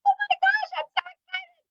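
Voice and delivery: high, squealing mock excitement; gushing excitement. Hostess gushing to the seller, high and squealy. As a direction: squeal it like a thrilled friend. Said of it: "And Brandy's like".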